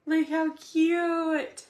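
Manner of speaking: high, slow gushing "aww" tone. Real, not acted. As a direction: coo over it, drawn out. Gushing over baby's gift.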